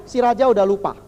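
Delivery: ordinary talk, nothing marked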